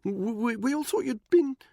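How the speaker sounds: high, loud, nervous stammer; stammering Buckingham. A narrator's reading. Buckingham cornered by Danny, stammering high. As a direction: trip over the words in a high upper-class voice.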